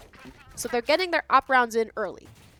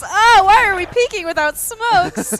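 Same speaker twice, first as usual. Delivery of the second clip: exasperated cry; 9 semitones higher, 12 dB louder than usual. Real, not acted. Team peeks without smokes.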